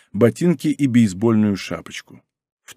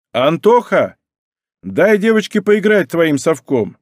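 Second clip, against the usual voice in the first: higher, louder father's call; 6 semitones higher, 4 dB louder than usual. A narrator's reading. David tells his son to share the scoop, calling out. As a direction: call across the beach to a child. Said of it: "крикнул Давид".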